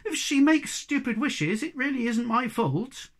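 high, indignant self-defence; loud, put-out complaint; protesting. Acted. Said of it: "protested the Snork".

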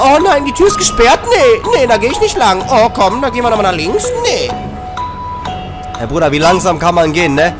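ordinary talk

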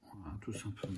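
breathy whisper to himself; whispered aside. Real, not acted. Painter whispering to himself over the figurine. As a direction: whisper as if concentrating on the brush. Murmuring to self while painting.

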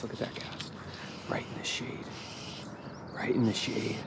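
quiet, absorbed fishing commentary; hushed concentration. Real, not acted. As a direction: say it under your breath while watching the cast. Hushed focus on a cast.

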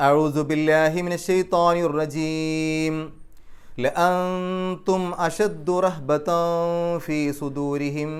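slow, chanted tajweed recitation; slow, measured chanted Quran reading. Shown as a demonstration. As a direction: recite the verse slowly in a melodic chant. Recites the verse.

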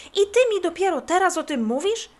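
high, loud indignant question; acted exasperated fury; angry outburst. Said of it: "Kubuś aż zatrząsł się z gniewu".

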